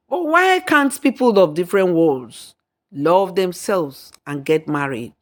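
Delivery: plain reading voice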